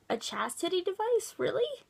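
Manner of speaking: high, incredulous rising question; appalled disbelief. Real, not acted. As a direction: climb sharply in pitch, unable to believe it.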